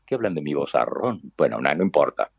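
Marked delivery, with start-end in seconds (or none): none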